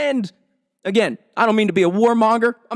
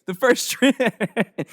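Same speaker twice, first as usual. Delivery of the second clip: giggling, can't keep a straight face; laughing through words; 4 semitones higher, much slower than usual. Real, not acted. Comic breaking up mid-joke, laughing. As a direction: try to say it while cracking up. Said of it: "I can't even say it with a straight face".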